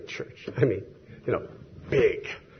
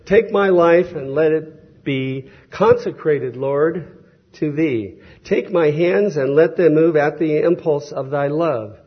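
slow, louder solemn recitation of the hymn words; hymn recital; 3 semitones higher, 5 dB louder than usual. Shown as a demonstration. Preacher reciting Havergal's hymn to the congregation, solemn. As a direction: declaim the verses reverently. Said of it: "Verse one".

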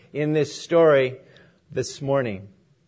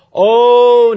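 loud, high, drawn-out protest; storyteller acting a manager's dismay; dismayed objection; 5 semitones higher, 11 dB louder than usual. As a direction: break in loudly to object. Manager objects to homeless man.

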